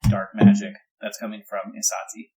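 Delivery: ordinary talk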